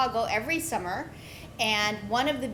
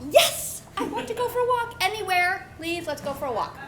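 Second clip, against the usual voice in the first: put-on much higher, laughing eager kid; eager yes; 6 semitones higher than usual. Bored eleven-year-old to cousin, laughing and pleading, higher. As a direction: jump at the chance to escape the game.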